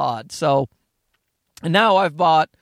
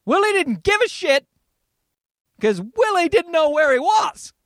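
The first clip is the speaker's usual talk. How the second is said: ranting shout; 9 semitones higher than usual. Real, not acted. Comedian rants to listeners about stoned Willie, shouting. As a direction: belt it out in disbelief.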